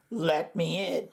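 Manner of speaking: soft, low croak through the door; croaking frog. A narrator's reading. Frog at the school door begging to come in, soft croak. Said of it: "croaked a voice".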